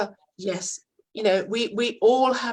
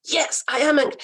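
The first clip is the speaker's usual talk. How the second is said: raised, louder angry client agreeing; mock angry client; 6 semitones higher, 6 dB louder than usual. Angry client snapping back at the salon manager. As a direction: play the furious client admitting it. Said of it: "they're likely to say yes to".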